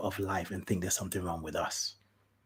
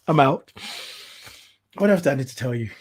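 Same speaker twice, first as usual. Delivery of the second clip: normal talking voice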